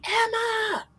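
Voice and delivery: high calling out of a name; retold yelling. Parent calling a daughter through a cracked door. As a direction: call into the house for her. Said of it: "I'm yelling for her frantically".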